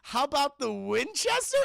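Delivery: put-on high naive boyfriend offer; clueless suggestion. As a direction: suggest the pub as if it's a good idea. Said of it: "He goes".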